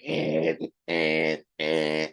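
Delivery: low, droning "eh", repeated evenly; imitated alarm noise. Imitating the girls when the emergency thing came on, low droning noise. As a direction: imitate a low buzzer, short repeated blasts. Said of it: "it was the girls going".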